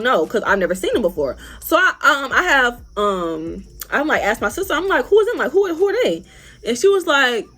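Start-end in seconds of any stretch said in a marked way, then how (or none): 5.1-6.2 urgent questioning. Retold or imitated. Asking sister about strangers.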